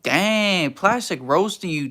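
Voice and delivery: usual speaking voice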